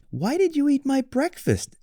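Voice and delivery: high cub voice; little bear. Acted. Little Bear questions Goldilocks, high cub voice.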